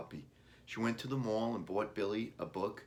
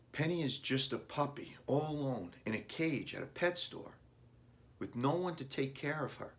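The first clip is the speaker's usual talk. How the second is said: put-on higher, pitying young boy; boy's worried thoughts; 7 semitones higher than usual. A narrator's reading. Boy picturing the lonely puppy, higher voiced. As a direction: fret over the puppy like a kid.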